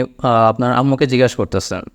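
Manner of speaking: ordinary delivery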